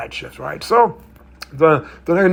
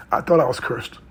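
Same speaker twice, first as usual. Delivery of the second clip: normal talking voice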